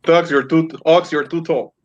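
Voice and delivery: normal talking voice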